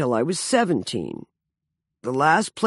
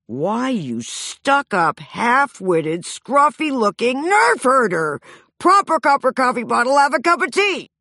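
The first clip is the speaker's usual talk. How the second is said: a hammed-up princess scolding a scoundrel in a drama school accent; mock Princess Leia insult; 7 semitones higher, 4 dB louder than usual. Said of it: "my performance as Princess Leia".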